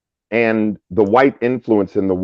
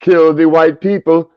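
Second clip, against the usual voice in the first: higher, louder, a lightly voiced comic bit; mock comic rant; 4 semitones higher, 4 dB louder than usual. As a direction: quote the punchline with a touch of showman. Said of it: "that was some funny shit".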